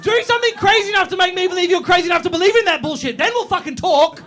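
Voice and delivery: high, loud rant; fired-up comic rant. Real, not acted. Ranting about half-hearted psychics.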